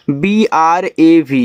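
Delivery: slow, letter by letter; spelling out. Shown as a demonstration. Teacher spelling a word for beginners, slow spelling out. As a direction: spell it out slowly for learners.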